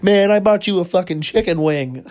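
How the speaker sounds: freaking out. Retold or imitated. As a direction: blow up over the chicken wings, half-mocking. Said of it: "started, like, freaking out".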